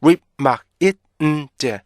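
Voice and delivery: "whip, 막, it, 음, 제" as separate stopped beats; punchy syllable-by-syllable drill with gaps; five-beat breakdown. Shown as a demonstration. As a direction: chant the pieces of remind one by one. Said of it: "총 다섯 개의 리듬으로".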